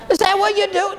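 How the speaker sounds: usual speaking voice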